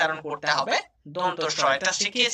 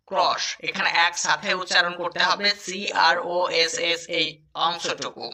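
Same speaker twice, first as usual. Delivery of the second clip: ordinary delivery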